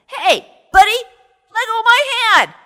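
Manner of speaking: high, fast, loud panicky cry; panicked squeal. Acted. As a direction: squeal as your hand sticks fast. Ananse stuck, panicking.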